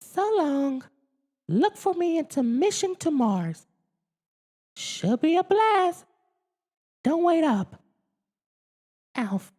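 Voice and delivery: very high squeaky alien voice; Alf's note. Acted. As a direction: read the note as Alf.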